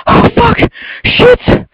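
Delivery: very high, voiceless panicked gasp of words; terrified swearing. Real, not acted. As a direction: gasp out curses in real fright. Still reeling from jump scare.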